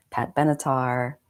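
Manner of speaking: ordinary delivery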